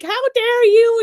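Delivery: high, loud scandalised shriek; mock outrage. Imagined crowd scolding a MAGA megaphone man, mock outraged shriek. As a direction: shriek it like an offended bystander. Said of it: "every motherfucker would be like".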